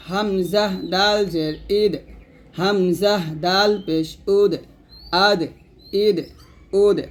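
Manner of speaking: measured drill, ইদ and উদ ending in a bounced দ; spring off the দ in আদ, ইদ, উদ; qalqalah on dal. Shown as a demonstration. Teacher drilling dal for learners, echoed দ in আদ, ইদ, উদ. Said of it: "কলকলা".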